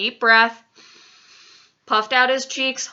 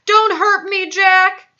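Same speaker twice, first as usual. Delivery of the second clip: high, loud terrified begging; pleading yell; 11 semitones higher, 9 dB louder, slower than usual. A narrator's reading. Dreaming giant begging Jack, pleading yell. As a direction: yell out a desperate plea. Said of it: "he yelled".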